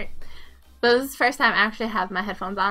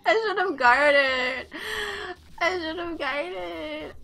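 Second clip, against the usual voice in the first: slow, drawn-out fake crying; higher, whiny mock sob; mock wailing; 7 semitones higher, much slower than usual. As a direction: moan regretfully, stretching every word. Lamenting own mistake.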